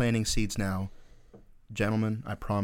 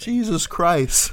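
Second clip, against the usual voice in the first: exasperated; 8 semitones higher, 6 dB louder, much slower than usual. Real, not acted. Exasperated reaction.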